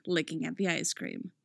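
plain reading voice

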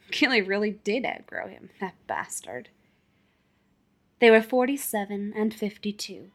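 usual speaking voice

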